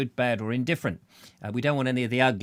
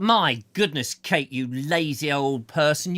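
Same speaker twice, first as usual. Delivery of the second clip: normal talking voice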